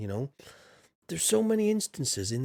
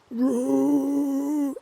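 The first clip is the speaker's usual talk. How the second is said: high, loud dinosaur roar; T-Rex roar; 13 semitones higher, 5 dB louder than usual. Shown as a demonstration. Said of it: "That's my T-Rex impression there".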